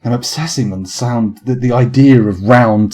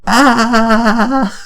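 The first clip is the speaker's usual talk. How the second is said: high sustained quavering "ah"; thin high wobble, held long; fragile wobbling "ah"; 12 semitones higher than usual. Shown as a demonstration. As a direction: hold a high, fragile vibrato note. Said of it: "that wasn't a very fragile sound".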